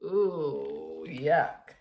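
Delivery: drawn-out grossed-out "ooh, yuck"; disgusted. Acted. Storyteller pulling a face at the monster, disgusted. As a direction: recoil at the smell.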